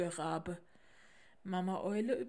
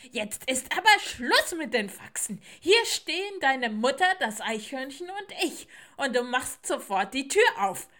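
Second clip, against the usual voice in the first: loud, higher beetle scolding; angry shouting; 6 semitones higher, 10 dB louder than usual. Acted. Stag beetle orders little owl to open up, angry shouting. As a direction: bellow orders at a closed door. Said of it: "klopfte der Hirschkäfer an und rief".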